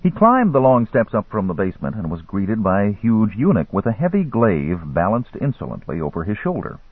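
ordinary delivery, nothing marked

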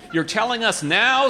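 fed-up dad asking; exasperated. A narrator's reading. Exasperated father.

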